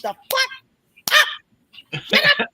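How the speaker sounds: high-pitched acted-out yelling at the screen; hammed-up fed-up outburst, pitch way up; mock exasperation. Acting out annoyance at screaming character.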